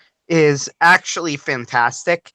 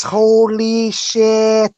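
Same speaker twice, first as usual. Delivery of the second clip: put-on slow, stretched accented curse; accented English curse; 7 semitones higher, much slower than usual. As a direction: drawl it in Japanese-accented English. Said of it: "He says".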